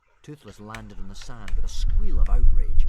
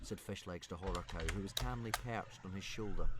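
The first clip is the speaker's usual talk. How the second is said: ordinary delivery, nothing marked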